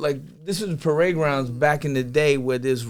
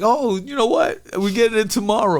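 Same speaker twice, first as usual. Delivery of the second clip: put-on nervous kid excuse; 8 semitones higher, 5 dB louder than usual. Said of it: "I was like".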